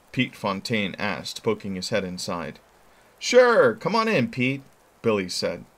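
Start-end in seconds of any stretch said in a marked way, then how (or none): 3.2-4.6 cheerful call. Acted. Boy calls friend in.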